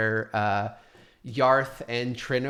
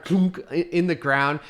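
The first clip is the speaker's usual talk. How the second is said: ordinary delivery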